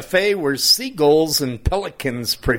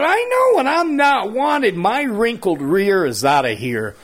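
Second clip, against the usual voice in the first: huffy retort; 7 semitones higher than usual. Retold or imitated. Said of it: "I said".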